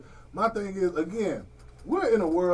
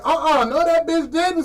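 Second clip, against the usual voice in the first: higher, louder indignant mom; a hint of an outraged mother cutting in; imitating his mom; 8 semitones higher, 5 dB louder than usual. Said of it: "my mom said".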